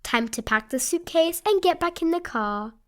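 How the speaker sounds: plain reading voice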